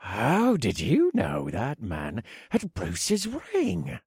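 breathy, slow awed question. Acted. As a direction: breathe it out in awe. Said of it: "Carlyle asked in a breathy voice".

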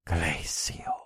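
voiceless, drawn-out incantation; whispered spell. Acted. Narrator casting ice on the warlock, whispering.

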